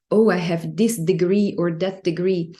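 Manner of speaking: normal talking voice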